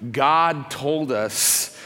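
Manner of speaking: very slow, stretched words; drawn-out confession. Retold or imitated. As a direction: drag out each word before confessing. Hesitant answer to the lady.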